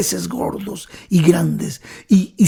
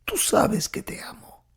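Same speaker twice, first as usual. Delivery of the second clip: higher, softer, near-whispered confession; tearful plea; 6 semitones higher, 3 dB quieter than usual. Acted. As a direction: plead through tears, a touch of weeping. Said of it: "Con lágrimas en los ojos le dijo".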